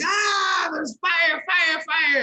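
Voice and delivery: high, hammed-up cry of fire; mock panic. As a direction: fake a panicked alarm to escape the call. Fake alarm to end prank.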